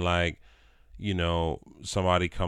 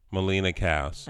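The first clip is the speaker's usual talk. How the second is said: ordinary talk, nothing marked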